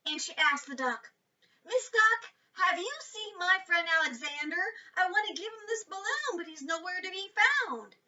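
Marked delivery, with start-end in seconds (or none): 1.7-8.0 put-on higher girl's voice, worried; Jessie asking. A narrator's reading. Jessie asks the duck.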